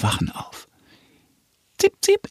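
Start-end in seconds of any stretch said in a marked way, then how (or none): 1.8-2.3 very high bird chirp; thrush chirp. Acted. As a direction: chirp it like a songbird. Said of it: "macht die Singdrossel".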